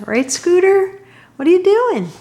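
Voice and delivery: high pet-talk voice. Real, not acted. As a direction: coo at the dog in a high voice. Talking to her dog.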